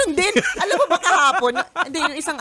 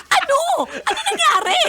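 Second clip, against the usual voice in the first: very high, alarmed what happened; flustered panic; 4 dB louder than usual. Retold or imitated. Wife caught up in the panic, very high alarmed voice. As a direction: mimic her own flustered alarm, high and rising. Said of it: "nataranta din ako".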